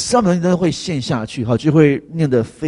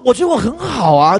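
smug self-assurance; 9 semitones higher, 3 dB louder than usual. Retold or imitated. Mocking self-righteous inner voice.

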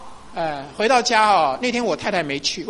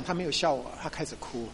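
ordinary talk

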